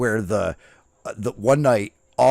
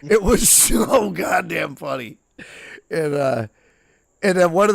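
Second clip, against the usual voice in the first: laughing; 5 semitones higher, 4 dB louder than usual. Real, not acted. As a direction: drag it out through real laughter. Cracks up repeating it.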